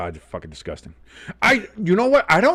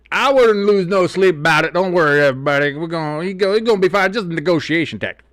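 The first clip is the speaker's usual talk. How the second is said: louder, higher mock team-owner voice; Jerry Jones; 5 semitones higher, 5 dB louder than usual. Host mimicking the owner's weekend comments, put-on owner voice. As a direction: imitate a blustering owner brushing off worries. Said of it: "Jerry Jones came out this weekend and said".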